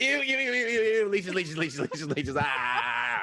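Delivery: high panicked "ew ew ew"; disgusted shrieking. Acted. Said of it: "I'm like".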